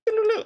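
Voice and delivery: quick sing-song doo-doo-doo, very high; high-pitched doo-doo noise. Retold or imitated. Streamer imitating a quick UI fix, high playful doo-doo. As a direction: chirp a little busy-fixing sound.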